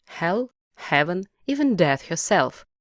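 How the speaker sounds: ordinary delivery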